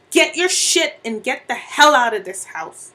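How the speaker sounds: loud, forceful eviction; angry order. Acted. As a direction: throw them out with punched words. Wife throws husband out.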